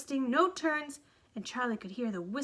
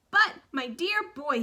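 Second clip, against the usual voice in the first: excited cry; 5 semitones higher, 5 dB louder than usual. A narrator's reading. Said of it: "he cried out".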